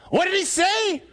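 high-pitched yell to a mate over the storm; shouted question. Acted. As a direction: shout it as if you could not hear. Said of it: "Rick turns to me and he says".

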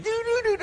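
high-pitched doorbell chime; high vocal mimicry of a ringing bell; imitated doorbell. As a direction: sing out doo-doo-doo like a doorbell. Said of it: "the doorbell's ringing".

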